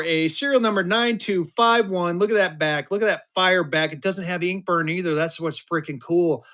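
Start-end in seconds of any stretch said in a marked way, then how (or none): none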